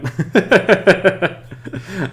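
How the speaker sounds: real, loud rapid-fire chuckle; hearty laugh. Real, not acted. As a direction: burst out laughing, high and pulsing. Streamer amused by chat name.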